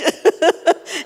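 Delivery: quick high giggle; short laugh. Real, not acted. Wife giggling while retelling the joke. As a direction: let a giggle slip out.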